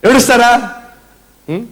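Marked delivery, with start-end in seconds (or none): none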